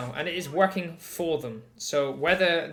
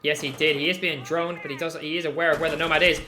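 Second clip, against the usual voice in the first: ordinary delivery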